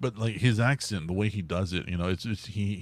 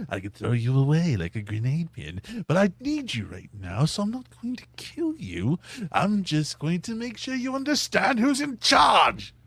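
Joker voice; 9 semitones higher, 5 dB louder than usual. Acted. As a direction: menace sweetly like a cartoon Joker. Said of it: "You know, it's like".

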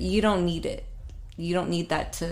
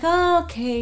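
mock reluctant acceptance; 10 semitones higher, 3 dB louder than usual. Girlfriend letting her boyfriend pay, mock reluctant acceptance. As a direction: act out a half-protesting surrender.

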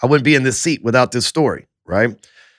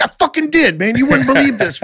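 a much higher, amazed laughing exclaim; excited retort; 12 semitones higher than usual. Retold or imitated. Telling the mob guy he really became a banker, excited and high. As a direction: pitch it up like you can't believe it yourself.

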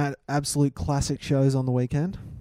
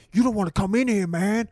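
higher-pitched American prisoner impression; mock prisoner voice; 6 semitones higher than usual. Prisoner scaring troubled teens on a TV show, hammed-up warning. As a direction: play an inmate warning off teenagers. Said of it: "the prisoners are like".

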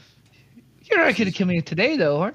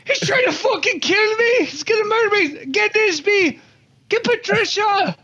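panicked yelling; 11 semitones higher than usual. Acted. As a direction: scream for help while running. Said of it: "running down the stairs yelling this".

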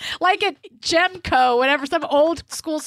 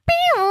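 high squeaky beep noise; imitated hearing aid beep. Friend mimics a hearing aid squealing, high mock beep. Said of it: "where it's like".

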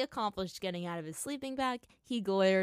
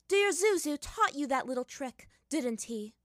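mocking taunt; 7 semitones higher, 5 dB louder than usual. Villain mocks the hero.

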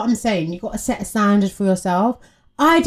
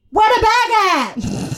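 high, drawn-out money demand; cheeky demand; 9 semitones higher, much slower than usual. Acted. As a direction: call it out high and slow. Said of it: "I would be like".

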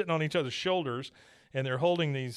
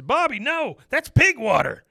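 much higher and louder motherly cry; imitating a shrieking mom; 11 semitones higher, 9 dB louder than usual. Mother yells at her son not to jump in, high and loud. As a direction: stop him with a horrified shout. Peggy voiced in retold episode.

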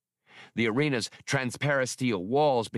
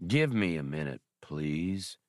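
a lower, slow begging droid drawl; slow pleading drawl; 5 semitones lower than usual. A narrator's reading. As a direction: beg wearily for a moment. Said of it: "Bollocks begged, his drawl even slower now".

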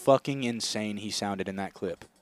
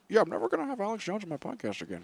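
mock Joe Rogan; 7 semitones higher, 8 dB quieter, faster than usual. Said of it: "he was like".

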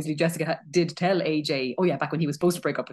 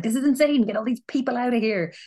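fed-up outburst; 6 semitones higher than usual. Retold or imitated. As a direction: imitate a bassist who has had enough. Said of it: "He's just like".

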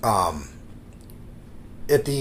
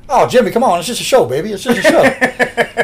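mock dismissive coaxing, pitched up; Honky Tonk Man; 7 semitones higher, 4 dB louder than usual. Wrestler ribbing his manager after the match, mock coaxing. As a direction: tease him, drawing out the last phrase high. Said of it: "Honky Tonk looked at him and he goes".

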